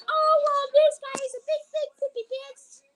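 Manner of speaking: high, loud teasing voice; high-pitched childish mockery; mocking taunt. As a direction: squeal the insult like a kid. Mocking someone on screen.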